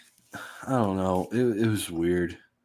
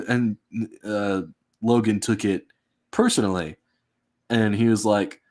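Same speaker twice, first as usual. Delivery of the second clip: normal talking voice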